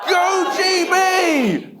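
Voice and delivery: high, slow, drawn-out stadium shout; crowd shout. Retold or imitated. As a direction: stretch it out like a roaring grandstand. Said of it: "I can hear the crowd shouting".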